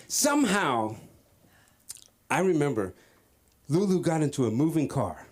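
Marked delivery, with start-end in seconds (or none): none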